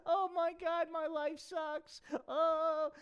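high mock moaning lament; Barbie moaning. Barbie runs out crying, mock moan. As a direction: whine about her life. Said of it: "pissing and moaning".

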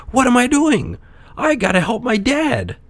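high, urgent puppet voice; alarmed puppet. A narrator's reading. Puppet suddenly remembers father.

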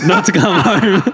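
slow, laughing through the quoted text; laughing quote. Retold or imitated. Retelling dad's locked-out joke to friend, laughing. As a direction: read dad's message while cracking up. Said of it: "Joel's dad sent me a message saying".

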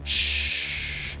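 long, soft hissing shush; hissed shush. A narrator's reading. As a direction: hush the younger child with a hiss. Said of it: "hissed the biggest girl".

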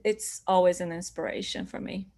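usual speaking voice

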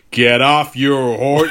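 put-on a gruff old-West lawman order; John Wayne drawl. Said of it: "he's not John Wayne".

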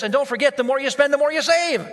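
a light mock salesman hyping the deal; salesman's pitch. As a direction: sell it like a slick car salesman. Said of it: "and then he also said this".

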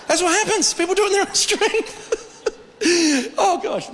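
high-pitched laughing aside. Real, not acted. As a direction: laugh knowingly at the crowd. Laughing at failed resolutions.

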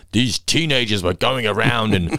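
drawn-out, put-on grumbling neighbour; slow it into a whingeing complaint; mock indignant complaint. Quoting estate neighbours complaining about candy raids, mock indignant.

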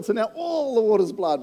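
normal talking voice

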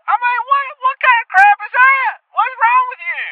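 furious outburst. Acted. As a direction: blow up at the host in disbelief. Caller's rant peaks.

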